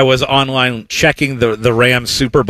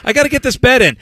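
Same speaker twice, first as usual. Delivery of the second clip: much higher, hammed-up panic to place a bet; frantic rush; 12 semitones higher, much faster than usual. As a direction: play the mock-frantic bettor shoving past old ladies. Himself pushing to the kiosk.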